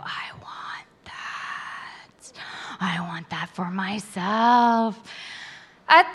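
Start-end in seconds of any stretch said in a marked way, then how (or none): 0.0-2.3 breathless slow whisper; whispered wish. Retold or imitated. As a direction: whisper it like a secret longing. Inner wish, hushed.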